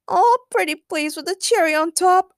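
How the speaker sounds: high, wheedling puppy-eyed plea; pleading. A narrator's reading. Harley begs the boys for help, wheedling. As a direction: beg sweetly with a pout, pitched high. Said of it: "Harley asked while making puppy eyes and quivering her lower lip".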